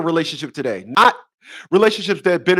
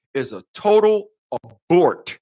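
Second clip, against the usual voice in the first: ordinary talk, nothing marked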